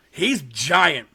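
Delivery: ordinary talk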